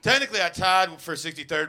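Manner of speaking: normal talking voice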